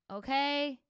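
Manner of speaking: ordinary delivery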